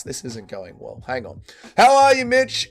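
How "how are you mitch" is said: high, loud streamer hello; loud hyped greeting. Real, not acted. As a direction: greet the chat with big energy. Streamer greets viewer loudly.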